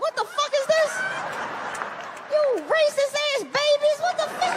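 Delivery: half-laughing indignant outburst; outraged. Retold or imitated. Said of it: "I'm like".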